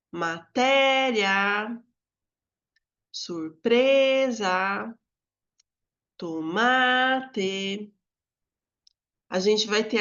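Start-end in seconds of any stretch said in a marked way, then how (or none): 0.1-7.9 matéria, surpresa, tomate drawn out very slowly, each leaning on its next-to-last syllable; stress the tonic syllable. Shown as a demonstration. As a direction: stretch each word so its stressed syllable stands out, té, pre, ma. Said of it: "vou contornar a sílaba tônica".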